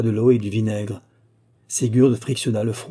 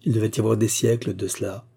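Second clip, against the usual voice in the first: ordinary delivery, nothing marked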